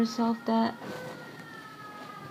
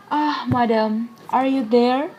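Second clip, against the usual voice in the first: louder, brightening greeting; pleased surprise; 3 semitones higher, 8 dB louder than usual. Acted. Langstrand spotting the lady in the arbor, loud and surprised. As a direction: act surprised to find her there.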